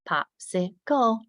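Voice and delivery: "Popsicle" slow, split into pop, si, cle; "Popsicle" counted out in syllables. Shown as a demonstration. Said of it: "segment a word into syllables".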